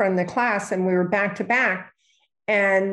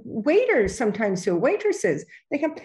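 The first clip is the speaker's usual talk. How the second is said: usual speaking voice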